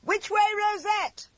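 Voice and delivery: high, slow shouted call over the noise; gleeful shout. A narrator's reading. William shouting to the driver while racing the carriage. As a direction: yell it happily from the driver's seat. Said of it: "shouted William".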